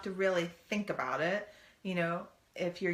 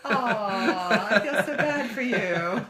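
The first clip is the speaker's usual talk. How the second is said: loud, high "aww" through laughter; hammed-up teasing commiseration; mock sympathy; 5 semitones higher, 9 dB louder, slower than usual. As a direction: gush fake pity, laughing.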